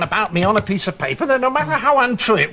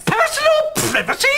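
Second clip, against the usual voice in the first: high, rising baffled question; puzzled question; 3 dB louder than usual. Acted. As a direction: ask it in sudden confusion. Realises the catch.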